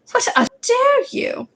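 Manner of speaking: teacher scolding a pupil, outraged; outraged McGonagall. Acted. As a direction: drag it out in scandalised fury. Retake of scolding.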